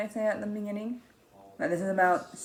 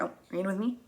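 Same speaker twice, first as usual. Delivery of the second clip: ordinary talk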